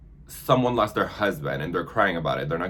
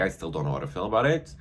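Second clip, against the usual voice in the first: normal talking voice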